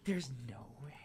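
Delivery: soft unvoiced there's no way; hushed astonished whisper; whispered disbelief. Real, not acted. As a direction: breathe it out in disbelief.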